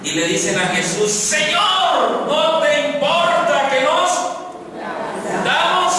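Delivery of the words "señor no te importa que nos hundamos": grito agudo y alto de pánico, lento; súplica aterrada. Acted. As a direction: clama a tu maestro mientras el barco se hunde. Discípulos claman a Jesús en tormenta. (los discípulos le gritan a Jesús, aterrados, con voz aguda)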